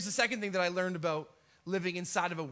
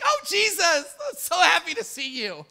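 high, loud sugary hello; mock sweet welcome, higher and louder; mock friendly greeting; 9 semitones higher, 7 dB louder than usual. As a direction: gush like a happy friend. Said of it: "this wasn't like a friendly".

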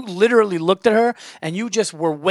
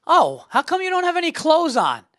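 higher-pitched mock woman on the phone; nosy phone caller; 10 semitones higher than usual. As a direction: imitate her oblivious question, pitched well up. Retold woman on a train call.

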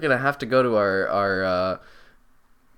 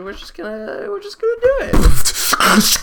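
hyped up; 8 dB louder than usual. Acted. Hype build into segment jingle.

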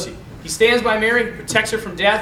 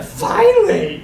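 high, slow exasperated relief; relieved finally; 10 semitones higher, 3 dB louder, much slower than usual. Retold or imitated. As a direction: drag it out like at last. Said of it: "she's like".

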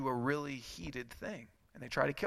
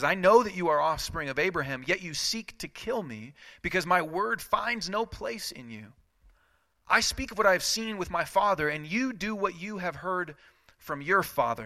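raised, louder preaching voice; forceful scripture reading; 4 semitones higher, 3 dB louder than usual. Acted. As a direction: proclaim it with building force. Reads Jesus' words with force.

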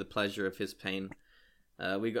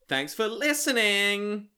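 announcer voice; 8 semitones higher, 4 dB louder, slower than usual. Acted. Podcast host signs off.

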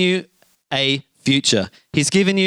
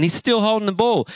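ordinary talk, nothing marked

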